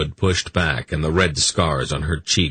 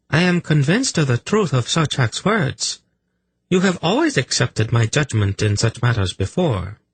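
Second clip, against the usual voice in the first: high-pitched priestess pressing her case; insistent priestess; 9 semitones higher than usual. A narrator's reading. Priestess arguing with her master, insistent.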